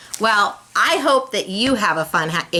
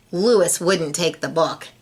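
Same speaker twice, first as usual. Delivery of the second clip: ordinary delivery, nothing marked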